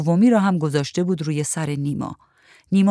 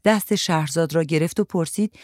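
ordinary delivery

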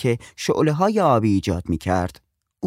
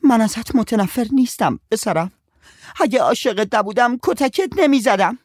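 crying mother; 12 semitones higher, 4 dB louder than usual. A narrator's reading. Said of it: "گریست".

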